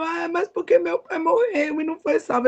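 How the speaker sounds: high-pitched mock whine; choramingo imitado. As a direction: protest like a sobbing child, hammed-up. Retells own tearful, whining protest. (choramingando em tom agudo, de imitação)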